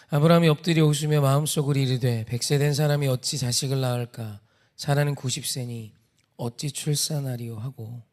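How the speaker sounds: measured, projected Bible reading; formal recitation, starting loud and settling; scripture reading. A narrator's reading. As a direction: read the verse out to a congregation. Reads the Bible passage aloud.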